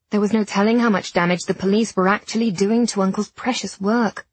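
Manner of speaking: usual speaking voice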